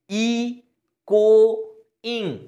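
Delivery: "ই, কো, ইং" with a clear pause after each syllable. Shown as a demonstration. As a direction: sound out "ই, কো, ইং" as separate beats. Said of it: "এবার syllable গুনো".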